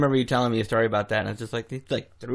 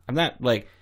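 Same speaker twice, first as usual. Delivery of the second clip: ordinary delivery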